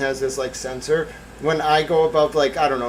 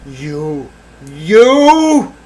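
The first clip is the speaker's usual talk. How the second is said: mock accusation; 2 semitones higher, 9 dB louder than usual. Streamer accusing chat in mock outrage. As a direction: point and accuse theatrically.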